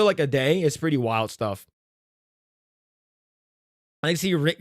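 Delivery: ordinary talk, nothing marked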